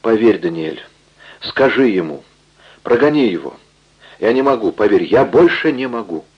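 plain reading voice

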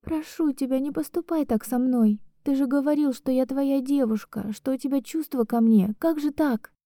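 high, soft tearful begging; sobbing plea. Acted. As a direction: plead through tears. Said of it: "Рыдаю и не могу остановиться".

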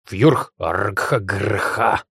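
slow, growly creature menace; threatening monster cry. A narrator's reading. Little monster warning approaching people, threatening cry. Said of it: "угрожающе завопил Жгутик".

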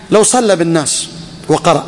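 usual speaking voice

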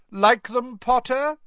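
high, loud, jeering schoolboy voice; loud sneer. A narrator's reading. Malfoy taunting Harry in the corridor, loud high sneer. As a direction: taunt him loudly so everyone hears. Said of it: "said Malfoy loudly".

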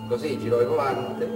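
normal talking voice

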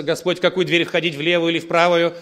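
loud, exaggerated plea for guidance; hammed-up, insistent questioning; mock over-eager prayer. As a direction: parody someone asking God about every trifle. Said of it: "ты ходишь, как с антеннами".

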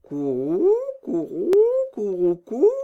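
rolled coos swooping upward; pigeon cooing. Acted. Two pigeons answering Gerda, cooing with rising swoops. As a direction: coo like a pigeon, each call rising. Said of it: "firent les pigeons".

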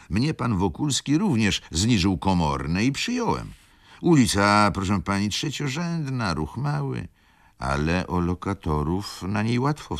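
character voice. A narrator's reading. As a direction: explain the street like an eager host. Character speaks to guests.

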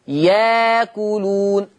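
slow ياكلون as "yaa-kuloon", no glottal stop; hamza turned to alif. Shown as a demonstration. Teacher shows Warsh's substitution, long "yaa" in ياكلون. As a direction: glide through a long aa in ياكلون. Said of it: "فتقرأ ياكلون".

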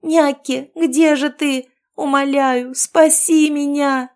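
high, loud desperate scream, slowing at the end; screaming for help. A narrator's reading. Captive girl screams for Nyakki to save her. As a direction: scream with all her strength for rescue. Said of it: "я закричала, что есть сил".

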